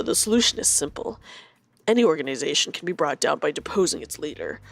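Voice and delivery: low, measured character reading; lightly voiced game character. Streamer voices a game character's plan, low and measured.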